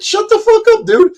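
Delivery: very high, loud snapping retort; telling him off. Retold or imitated. Narrator snapping at the angry rocker, very high loud retort. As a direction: shut him down hard.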